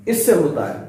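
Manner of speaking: ordinary talk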